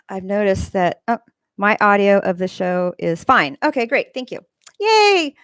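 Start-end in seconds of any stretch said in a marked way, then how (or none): 4.8-5.3 high little cheer. Real, not acted. Streamer told the audio is fine, cheering. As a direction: celebrate with a high-pitched squeal of relief.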